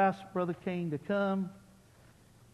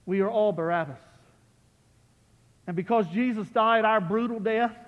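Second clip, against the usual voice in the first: normal talking voice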